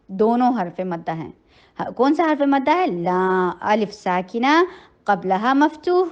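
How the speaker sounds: normal talking voice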